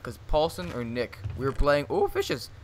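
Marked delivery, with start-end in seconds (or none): 1.9-2.5 high mock squeal. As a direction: squeak it out in delight. Exclaims game catchphrase.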